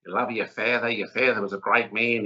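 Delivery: imitating an Australian well-wisher, badly; broad mock-Australian voice; terrible Australian accent. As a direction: put on a hammy Australian accent. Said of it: "that's a terrible accent".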